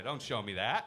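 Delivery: ordinary delivery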